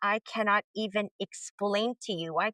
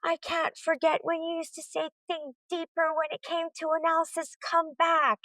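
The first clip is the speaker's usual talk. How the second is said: imitating students; 7 semitones higher than usual. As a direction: mimic the students calling her back, higher. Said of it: "a bunch of them".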